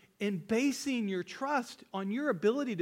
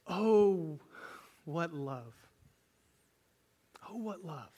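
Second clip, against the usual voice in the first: awed; slower than usual. Real, not acted. Awed exclamation.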